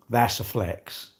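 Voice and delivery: slow smug thought. Retold or imitated. Smug inner thought about heart rate.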